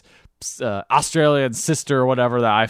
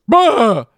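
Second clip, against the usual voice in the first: mock monster roar; 10 semitones higher, 7 dB louder than usual. Retelling Bigfoot scaring family.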